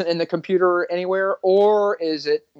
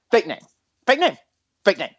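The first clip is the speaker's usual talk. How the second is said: "fake name" repeated as separate short jabs, pauses between; repeated accusation; 4 semitones higher than usual. Cop needling a lying driver, "fake name" repeated between pauses. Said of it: "that's literally exactly how I say it".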